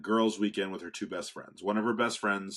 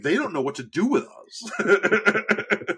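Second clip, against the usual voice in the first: laughing; 11 semitones higher, 8 dB louder, slower than usual. Retold or imitated. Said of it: "I was like".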